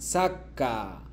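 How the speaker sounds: ordinary delivery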